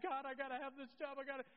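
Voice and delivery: hurried, mildly desperate prayer; desperate plea. Acted. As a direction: plead as if you must have it. Acted desperate plea to God.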